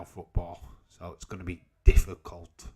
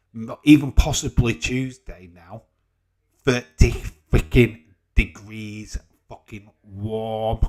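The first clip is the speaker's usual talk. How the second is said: ordinary delivery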